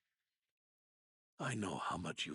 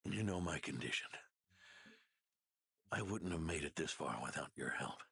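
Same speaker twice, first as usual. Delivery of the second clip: ordinary talk, nothing marked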